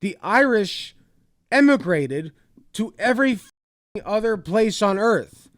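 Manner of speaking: high, loud exasperated yelling; furious rant. Real, not acted. Vlogger yelling at the clip's speaker, furious and loud. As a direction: blast him down, fed up.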